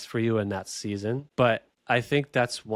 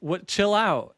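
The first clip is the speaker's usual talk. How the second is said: higher-pitched mock wife; lightly acted higher retort; imitating wife; 8 semitones higher, 3 dB louder, slower than usual. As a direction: quote your wife, pitch lifted. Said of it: "And she's like".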